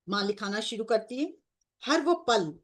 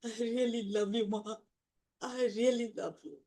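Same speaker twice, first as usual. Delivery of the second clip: slow, softer, tender and heartfelt; tender devotion; 3 dB quieter, much slower than usual. Real, not acted. Devotee speaking softly to the divine mother, tender. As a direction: murmur your love slowly to her.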